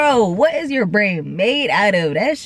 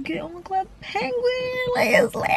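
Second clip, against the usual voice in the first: mimicked phone chatter; 12 semitones higher, 5 dB quieter than usual. Kid calling friends about a game, high mimicry. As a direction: imitate an excited kid on the phone, pitched high.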